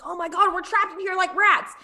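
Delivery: high, hammed-up panicky squeal; mock panic. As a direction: play Seth freaking out, high and jokey. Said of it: "He's like".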